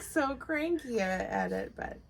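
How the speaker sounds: ordinary talk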